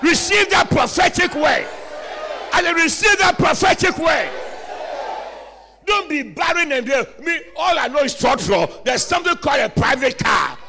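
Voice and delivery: loud, driving prophetic declarations; rousing pulpit call-and-response; full-force preaching. Acted.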